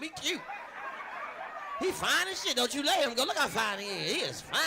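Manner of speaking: high, loud, gushing mock admiration; sassy mock praise. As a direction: camp it up praising him. Mocking wife's admirer, voiced.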